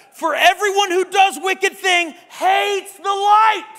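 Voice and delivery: loud, high, slow scripture proclaim; preacher shouting scripture at the congregation; preaching shout. Real, not acted. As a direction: hammer the verse out to the room. Quoting scripture forcefully.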